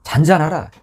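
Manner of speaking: brief, commanding rebuke; mock rebuke. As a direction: imitate Jesus ordering wind and waves still. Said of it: "꾸짖잖아요".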